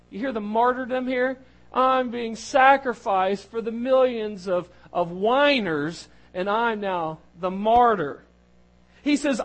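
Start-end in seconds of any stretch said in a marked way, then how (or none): 1.7-8.2 louder, slow self-pitying complaint; mock martyr Moses. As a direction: lay the martyrdom on thick, slowly.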